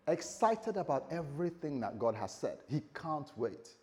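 usual speaking voice

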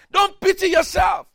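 shouted exhortation. Acted. Preacher exhorting the congregation, shouted. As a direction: hit them with it.

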